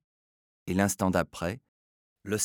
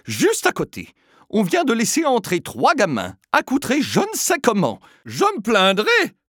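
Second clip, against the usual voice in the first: high, loud indignant ranting; scandalized lady threatening to complain to Filia; outraged complaint; 14 semitones higher, 8 dB louder than usual. Acted. Outraged lady ranting.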